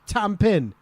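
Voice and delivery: "Tampin" ending on N, no G; silent final G. Shown as a demonstration. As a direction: drop the G at the end of "Tampin". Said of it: "Welsh would not say the G".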